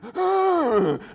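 high, loud gasping breath. Acted. Preacher showing how awful he looks running, gasping. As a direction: gasp like a wrecked runner.

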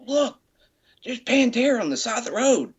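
put-on high-pitched, excited teenage kid. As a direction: mimic a thrilled kid spotting his idols. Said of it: "the kid goes".

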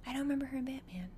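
fast whisper; whispered aside. Real, not acted. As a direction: whisper it under your breath, quickly. Aside while recalling actress.

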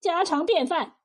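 a touch of annoyance, brushing him off; curt irritation. Acted. Liu answers the unwelcome officer's question about the pot, mildly irritated. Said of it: "没好气地说".

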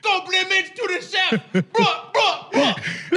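very high, hammed-up shout; mock scream. Co-host retelling the drive-thru, high mock scream. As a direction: imitate a scream to the mountaintops. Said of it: "and screamed to the mountaintops".

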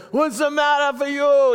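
a hint of a Yiddish accent, a mock complaint to God. Preacher voicing Paul complaining to God, with a light Yiddish accent. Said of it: "Imagine with his Yiddish accent".

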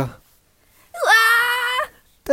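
high, drawn-out screams for help; mock panic. Villagers crying for help from the ship, high mock panic. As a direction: wail for help, hammed-up.

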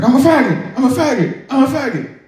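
high, loud yelled chant; repeated forced shout. Retold or imitated. Private yelling repeatedly for the drill sergeant, high loud yell. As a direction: shout it over and over on command. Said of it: "He's like".

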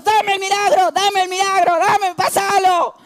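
high, pushy cry for the miracle; demanding shout. Retold or imitated. Believer yelling at God for a miracle, high demanding shout. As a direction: demand it like a teammate wanting the ball. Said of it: "estamos con Dios".

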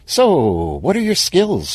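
breezy corporate cheer; bright, chirpy. Acted. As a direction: ask brightly with an upswept pitch. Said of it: "who smiled at him brightly".

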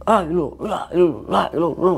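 imitated singsong chanting. Retelling the walker leaving the tent, imitated singsong chanting. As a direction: lilt nonsense syllables up and down. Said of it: "kayaknya nyanyi gitu".

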